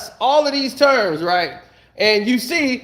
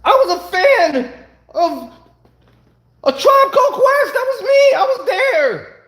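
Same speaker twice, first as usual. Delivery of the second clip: mock fan impression; 11 semitones higher, 3 dB louder than usual. Mocking the filmmaker who claims fandom, high put-on voice. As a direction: squeal it like a proud superfan. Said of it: "Him and Rappaport".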